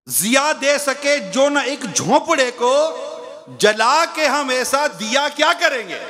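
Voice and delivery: measured poetic recital, slowed; recited couplet. Acted. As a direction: savour each line of the poem. Reciting a verse.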